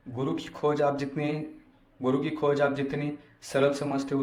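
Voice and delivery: normal talking voice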